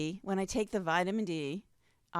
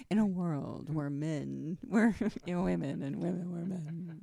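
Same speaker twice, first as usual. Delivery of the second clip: low, slow trailer-narrator spoof; mock trailer voice; 3 semitones lower than usual. Host continuing the film-title joke, mock trailer voice. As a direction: intone it low, breaking into a laugh.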